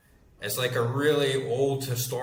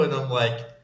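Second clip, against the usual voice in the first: ordinary talk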